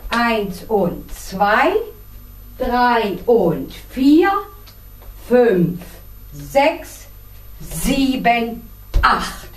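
slow, evenly spaced dance count. Shown as a demonstration. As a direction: count each step out slowly, leaving gaps between. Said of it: "noch einmal ganz langsam".

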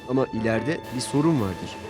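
ordinary delivery

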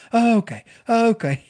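high, loud, flustered okays; awkward soothing. Retold or imitated. Uncomfortable people slapping a crier's back, high and flustered. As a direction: fake comfort covering unease.

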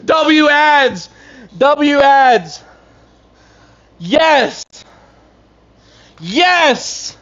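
loud, high yelling of yes; real excited hollering over good news; celebrating shouts. Real, not acted. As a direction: cheer at the top of your lungs. Ads appear during stream, celebrating.

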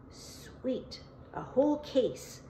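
hushed, greedy relish. A narrator's reading. Dana learning where the cigarettes are stashed, hushed greedy delight. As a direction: breathe the first word, then lift the pitch. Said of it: "Dana said greedily".

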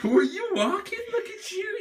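high, giggling coo. Real, not acted. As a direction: laugh while cooing. The same question, now laughing.

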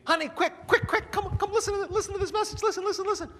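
high, loud, hurried and half laughing; frantic urgency. Acted. Spouse calling partner to hear the message, high and frantic. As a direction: rush them to the machine.

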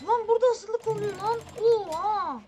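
loud and high, yelling at the screen; hyped gamer shout at a lucky pull; excited shout. Real, not acted. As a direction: blurt it out, thrilled by the drop. Big loot drop, shouting.

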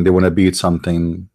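ordinary delivery

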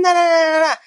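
very high squeaky na-na babble; wife's arguing mimicked. Husband mocks wife in the quarrel, high squeaky babble. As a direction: play the wife nagging back, piping high. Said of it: "and she was saying".

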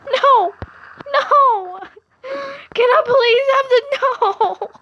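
high, loud desperate pleading over lost diamonds; real frantic shouting. Real, not acted. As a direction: beg at the top of your voice, panicking. Friend takes his diamonds.

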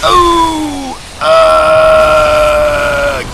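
long, loud, high wail; drawn-out scream of alarm; startled scream. Real, not acted. Screams at snake in outhouse.

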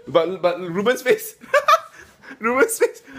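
high giggling fits between words; helpless high laughter at a friend; laughing. Real, not acted. Laughing at Ruben's face.

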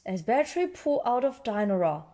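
ordinary delivery, nothing marked

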